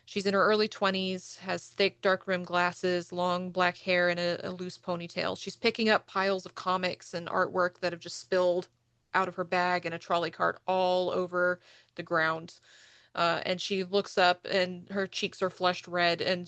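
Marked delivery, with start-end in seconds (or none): none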